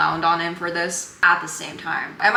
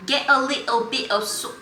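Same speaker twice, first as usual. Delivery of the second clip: another go at a mock British chef, higher; a deliberately bad British accent attempt; 6 semitones higher than usual. Retrying the British chef line, bad British accent. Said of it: "I understand that that was horrible".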